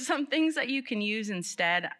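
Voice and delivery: ordinary delivery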